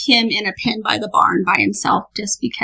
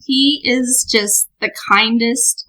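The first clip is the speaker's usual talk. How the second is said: drawn out; slower than usual. Real, not acted. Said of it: "Pistol is a sweetheart".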